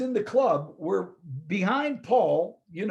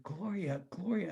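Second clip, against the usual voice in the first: much softer, trying to place the name; half to himself, searching his memory; puzzled murmur; 12 dB quieter than usual. Retold or imitated. Caller names herself, he murmurs her name, puzzled. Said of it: "I was really struggling".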